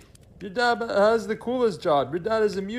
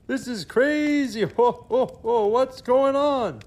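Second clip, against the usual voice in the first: louder, higher, bewildered cry; flustered outburst; 4 semitones higher, 4 dB louder than usual. Acted. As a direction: act out Santa losing his cool in disbelief. Santa panics over baffling letters.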